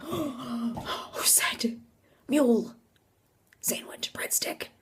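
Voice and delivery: breathy, alarmed whisper. Retold or imitated. Teller asking who said the chant, alarmed whisper. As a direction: whisper it with pauses between the words. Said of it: "And I'm, like, whispering".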